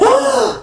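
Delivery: sharp high gasp of shock. Retold or imitated. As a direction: gasp in alarm at the news. Hearing critic's name.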